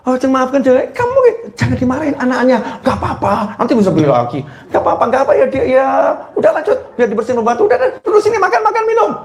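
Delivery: put-on high, sugary reassurance to someone else's child; sweet mother to guest's child. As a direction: fuss sweetly over the friend's boy. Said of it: "Temennya marah-marah". (ibu manis menenangkan anak teman)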